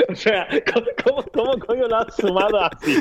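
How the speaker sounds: high-pitched helpless laughter through the words; laughing too hard to get the words out. Real, not acted. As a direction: crack up and talk through the laughs. Laughing at co-host's joke.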